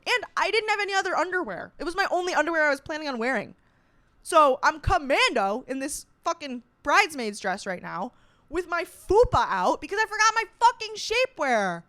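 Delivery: high-pitched, worked-up complaint; exasperated rant. Real, not acted. Bridesmaid stuck commando in her dress, exasperated rant. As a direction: rant with rising, swooping exasperation.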